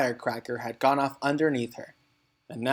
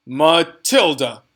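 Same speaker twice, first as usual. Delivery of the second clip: slow, loud barked name; furious headmistress barks at Matilda; 3 semitones higher, 10 dB louder, much slower than usual. A narrator's reading. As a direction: bark the name like a drill sergeant. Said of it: "she barked".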